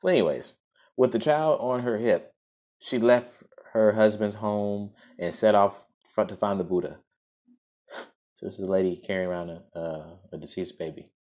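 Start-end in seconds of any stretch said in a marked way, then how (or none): 1.0-7.0 clear, fuller storytelling voice; story narration. Acted. Host reads the story to listeners, in a storybook narration. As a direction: read it out like a storybook.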